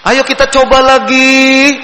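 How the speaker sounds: a gentle, drawn-out "Ayo kita coba lagi"; kindergarten teacher voice. Shown as a demonstration. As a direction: coax the kids like a gentle preschool teacher. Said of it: "guru-guru T K itu biasanya belakangnya itu panjang terus".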